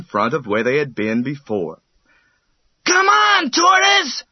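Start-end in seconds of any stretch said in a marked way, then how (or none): 2.8-4.2 high, loud anxious call; anxious cheering. A narrator's reading. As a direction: urge him on. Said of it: "called his friends anxiously".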